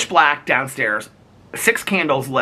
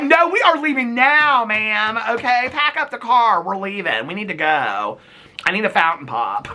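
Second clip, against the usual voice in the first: bossy take-charge; 8 semitones higher than usual. Pretend. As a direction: order everyone out at once. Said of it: "I'd have been like".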